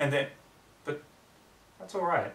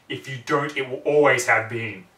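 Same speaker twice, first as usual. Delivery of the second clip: louder, slow pressing; louder insistence; 10 dB louder, slower than usual. A narrator's reading. Voice warning Twilight, louder and slow. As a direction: press it home. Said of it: "paired lines".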